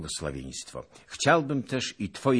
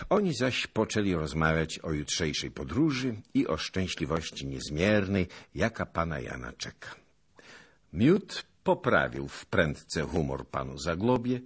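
ordinary delivery, nothing marked

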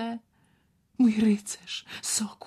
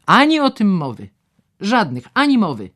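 stern refusal; 5 semitones higher, 11 dB louder than usual. Acted. Doctor flatly refuses.

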